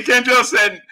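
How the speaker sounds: ordinary talk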